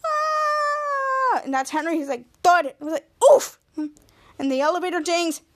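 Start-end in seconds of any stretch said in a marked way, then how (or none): 0.1-1.4 long, very high scream; sustained mock shriek. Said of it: "And they're screaming". 3.3-3.6 short, sudden oof; abrupt mock grunt; imitated impact grunt. Imitates Henry landing with a thud.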